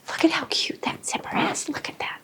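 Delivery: soft, whispered aside to viewers; hushed, voiceless admiration; admiring whisper. Real, not acted. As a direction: whisper it like a delighted little secret.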